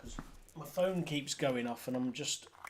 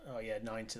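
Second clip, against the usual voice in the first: ordinary talk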